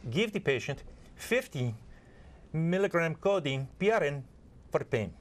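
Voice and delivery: put-on an Indian-accented doctor reading out a dosage; Indian doctor's accent. Indian doctor phoning a nurse with an order, in a light Indian accent. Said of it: "an Indian doctor who calls in medication".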